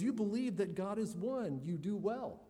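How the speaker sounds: mocking, sarcastic scripture quote. Shown as a demonstration. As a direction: let the sarcasm drip. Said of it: "you can sense James's sarcasm here".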